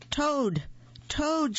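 high, falling call of a name; shouting. A narrator's reading. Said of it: "shouted Frog".

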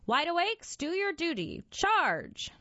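narrated battle cry, pitched well up; rallying shout. A narrator's reading. Captain sends his Wide Awakes at the hecklers, shouting. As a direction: call the troops to charge, high and forceful. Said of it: "Then he shouted".

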